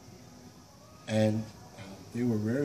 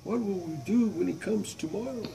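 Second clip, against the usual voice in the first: frightened villagers; 8 semitones higher than usual. Acted. Frightened people ask.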